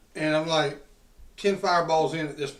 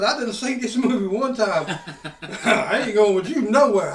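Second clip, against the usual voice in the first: higher, louder, laughing refusal; retold defiant yell; 4 semitones higher, 6 dB louder than usual. Retelling refusing to go with the officer, laughing yell. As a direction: shout it while cracking up.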